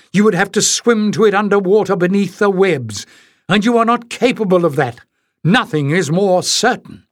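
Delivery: lightly voiced guardian, scornful; narrator's Penn, dismissing the travellers; Penn's scornful lecture. A narrator's reading.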